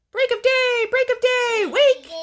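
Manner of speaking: very high, loud crowing call; rooster crow. A narrator's reading. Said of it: "stretched out his neck and crowed".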